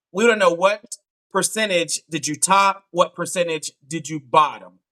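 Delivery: ordinary delivery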